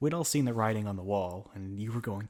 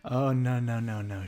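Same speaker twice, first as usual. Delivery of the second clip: normal talking voice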